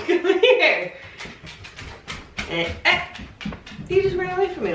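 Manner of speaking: high, loud sing-song voice for the pet; pet talk. Real, not acted. Talking to her pet.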